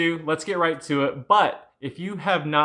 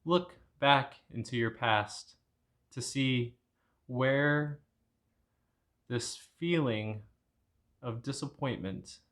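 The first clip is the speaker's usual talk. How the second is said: calm meditation guide, much softer; guided meditation; 7 dB quieter than usual. Acted. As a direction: talk them gently through the breaths and the painful memory. Leads a guided anger meditation.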